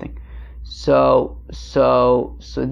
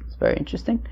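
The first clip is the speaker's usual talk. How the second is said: softly unvoiced repeat; whispered aside; 6 semitones higher, 11 dB quieter, faster than usual. Real, not acted. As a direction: whisper it to yourself. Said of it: "which is very interesting".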